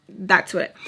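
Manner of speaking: ordinary talk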